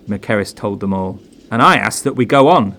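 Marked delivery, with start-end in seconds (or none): 1.5-2.7 indignant. Acted. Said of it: "said Griffiths indignantly".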